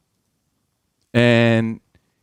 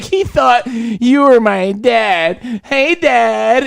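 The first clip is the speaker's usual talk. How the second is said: slow teasing singsong; 6 semitones higher, much slower than usual. Retold or imitated. Jordan teasing him.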